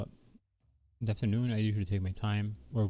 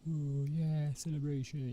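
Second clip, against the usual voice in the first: cheerful celebrating voice; 7 semitones higher, 3 dB quieter, slower than usual. Pretend. Voicing happy celebrating characters.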